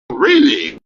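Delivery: high incredulous really. Real, not acted. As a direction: toss back a high, disbelieving question. Disbelieving retort in argument.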